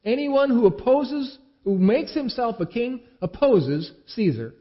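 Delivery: loud, high, slow threatening shout; a mob shouting its threat, slow and high; crowd shouting. A narrator's reading. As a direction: press Pilate with a hammered shout.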